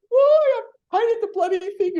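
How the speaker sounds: high, loud indignant squeak; retold indignant disbelief. Assistant protesting to Dave she did the job, high indignant squeak. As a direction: protest like you did nothing wrong. Told she's in trouble.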